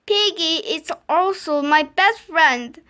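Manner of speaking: plain reading voice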